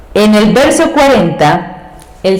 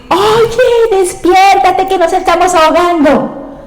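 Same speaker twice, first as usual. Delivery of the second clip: very high, frantic wake-up cry; panicked disciples; 11 semitones higher than usual. Acted. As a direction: shake him awake in alarm. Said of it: "de decir". (discípulos desesperados, grito muy agudo)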